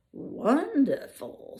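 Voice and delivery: the lion admires his reflection; admiring. Acted. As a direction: marvel at yourself in the mirror. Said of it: "said the lion".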